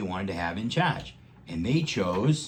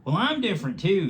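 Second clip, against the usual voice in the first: high, louder friendly voice; the badger bravely speaking up first; Pumpernickel the badger; 11 semitones higher, 6 dB louder than usual. A narrator's reading. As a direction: chime in brightly. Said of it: "he said".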